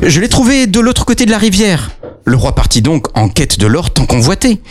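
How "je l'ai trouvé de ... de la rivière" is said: sly hero. Acted. Hero answers king.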